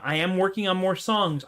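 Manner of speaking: ordinary talk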